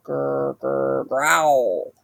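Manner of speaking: low rumbling growl. A narrator's reading. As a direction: growl like a hidden beast. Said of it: "scariest sound of all".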